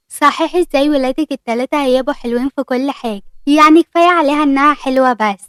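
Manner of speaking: plain reading voice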